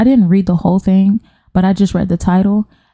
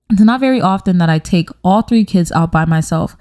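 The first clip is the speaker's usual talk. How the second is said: ordinary talk, nothing marked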